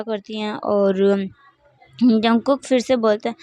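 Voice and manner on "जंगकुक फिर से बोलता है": ordinary delivery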